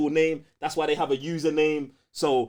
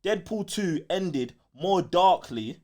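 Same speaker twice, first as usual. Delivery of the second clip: reading slowly; slower than usual. Retold or imitated. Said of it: "Man said".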